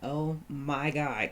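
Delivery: ordinary talk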